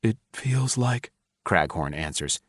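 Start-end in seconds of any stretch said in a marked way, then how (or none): 0.0-1.1 strained choking voice. A narrator's reading. Man choking on the recording.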